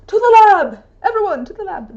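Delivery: loud, high mad scientist shout. Pretend. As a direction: call everyone to the lab like a madman. Mad scientist rallying cry.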